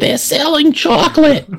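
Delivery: very high, slow silly squeak; squeaky high voice. Pretend. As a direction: squeal it like a cartoon. Silly chocolate joke.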